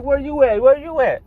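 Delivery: high, pressing questions from the homeboy; urgent friend. Retold or imitated. Friend Caleb on the phone demanding his location, high and urgent. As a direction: fire it off twice, voice up high.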